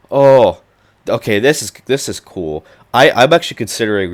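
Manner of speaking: sudden loud delighted oh; light up at a rediscovered project; excited. Real, not acted. Finds a project he likes.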